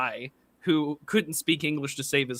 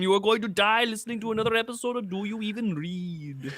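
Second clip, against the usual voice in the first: a hint of an Austrian Schwarzenegger accent; Schwarzenegger impression; 6 semitones higher than usual. Host welcoming listeners to the show, a hint of a Schwarzenegger accent. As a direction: announce it like a faint Schwarzenegger. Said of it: "getting into Aiden and Arnold Schwarzenegger".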